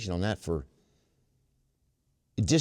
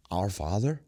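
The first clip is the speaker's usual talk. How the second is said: wondering question; 2 semitones lower than usual. Shown as a demonstration. Pondering the prayer aloud before the group, amazed. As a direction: marvel at the words. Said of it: "the Our Father prayer starts with that".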